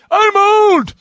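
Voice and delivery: very high, loud war cry; Zuni fighting man. Acted. Imitating the doll from Trilogy of Terror, frenzied yell. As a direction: shriek it like a crazed attacking doll. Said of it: "I'm like the Zuni fighting man".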